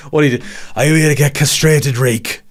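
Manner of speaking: loud mock-villain voice; Ramsay Bolton. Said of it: "fucking Ramsay Bolton".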